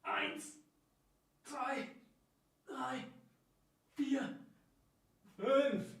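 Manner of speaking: tired counting of last reps; doing push ups. Real, not acted. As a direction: squeeze out the final counts. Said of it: "jetzt noch fünf". (erschöpft beim Liegestützen)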